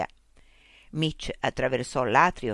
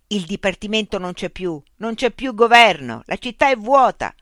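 higher, much louder furious yell; angry outburst; 6 semitones higher, 8 dB louder than usual. Acted. Mitch yelling at the machine after banging his fist. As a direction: pound out the truth in anger. Picchiò il pugno.

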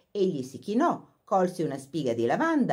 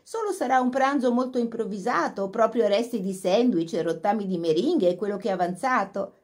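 gushing phone chat; 7 semitones higher, 5 dB louder than usual. A narrator's reading.

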